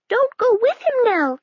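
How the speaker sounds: a tiny rabbit begging her not to go; rabbit's warning. Acted. Rabbit Peter warns Nell.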